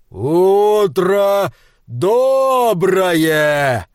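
slow, booming giant rumble. Acted. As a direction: speak like an avalanche rolling down. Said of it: "голосом горной лавины откликнулся".

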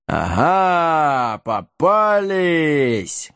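slow, hoarse giant voice with a cry; hoarse, muffled giant. Acted. Giant catching the drunken heroes, hoarse and very slow. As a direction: drag it out like a dim brute catching prey. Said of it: "Голос великана был хриплый и глухой".

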